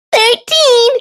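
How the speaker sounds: high yelled answer; yelling. Acted. Thistle beating Goose to the answer, yelled high. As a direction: blurt it out high before anyone else. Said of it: "she yelled before Goose".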